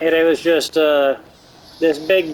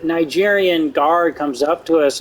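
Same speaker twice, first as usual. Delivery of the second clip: usual speaking voice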